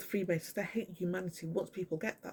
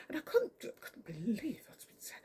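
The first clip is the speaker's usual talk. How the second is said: whisper; 6 semitones higher than usual. Real, not acted. Stunned, drops to whisper.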